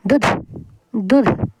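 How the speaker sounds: दूध said as a quick "dudh"; brief vowel in each दूध; short vowel. Shown as a demonstration. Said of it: "जल्दी बोल रहे हैं".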